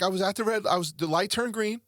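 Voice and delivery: a hint of his teenage daughter, high and earnest; quoting his teen daughter. Dad voices daughter telling crash.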